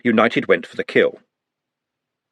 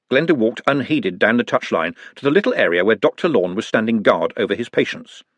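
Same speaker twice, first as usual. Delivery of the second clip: ordinary delivery, nothing marked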